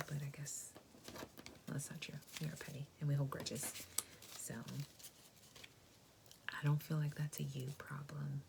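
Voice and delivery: ordinary talk, nothing marked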